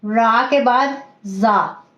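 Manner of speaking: teacher lingering on each letter name; slow, spelled out. Shown as a demonstration. Teacher naming letters to students, very slowly. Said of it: "एक आता है".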